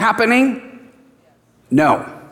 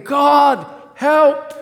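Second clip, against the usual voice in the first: crying out to God; 8 semitones higher than usual. Retold or imitated. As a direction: call up to heaven for help. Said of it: "he lifts his hands saying".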